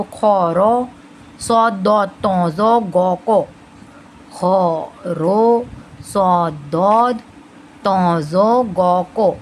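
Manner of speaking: very slow "खौरो सौदो तौजो गो को"; full-mouthed, drawn-out "तौजो गो को" for learners; heavy-letter mnemonic. Shown as a demonstration. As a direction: stretch each rounded "o" in "खौरो सौदो". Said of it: "ऐसे याद रखेंगे heavy letters को".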